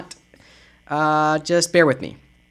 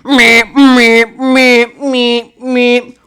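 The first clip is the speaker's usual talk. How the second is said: louder beeping alarm imitation; alarm meeps; 2 semitones higher, 4 dB louder, much slower than usual. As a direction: mimic a trouble alert siren. Trouble alert alarm sound.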